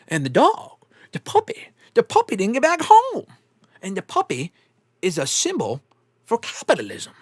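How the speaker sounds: halting movie-quote delivery with long pauses; movie character impression. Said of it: "Like, the way he talks".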